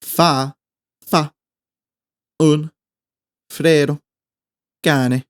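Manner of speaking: each word slowly apart. Shown as a demonstration. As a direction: say each word alone for learners. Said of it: "In Spanish".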